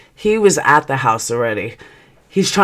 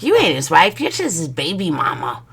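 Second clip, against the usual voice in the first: mimicked sassy retort. Other woman putting down the wife, mimicked sass.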